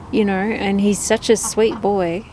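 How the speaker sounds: ordinary talk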